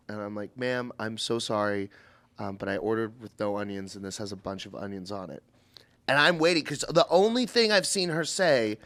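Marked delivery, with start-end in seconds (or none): 0.6-5.4 soft, apologetic politeness; very sweet. Retold or imitated. A customer politely asks the scary cook to fix his order, soft and sweet. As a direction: soften it like you're begging a favour. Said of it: "I try to be very sweet".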